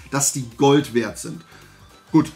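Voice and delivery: normal talking voice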